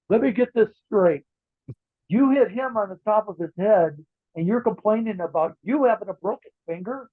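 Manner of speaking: a hint of Pat's French-Canadian accent, incredulous; Pat Patterson's accent. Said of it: "With that accent of his".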